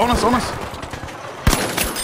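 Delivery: high-pitched panicked call; alarmed high yelp. Real, not acted. Enemy on them, alarmed.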